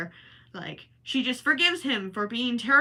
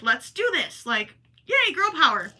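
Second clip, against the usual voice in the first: put-on high, loud girl-power cheer; retold cheering; 6 semitones higher, 6 dB louder than usual. Performer recalling her delight at the changed ending, cheering.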